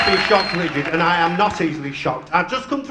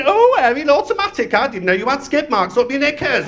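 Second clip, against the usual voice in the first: mock advert voice; 6 semitones higher than usual. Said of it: "coming round your house and going".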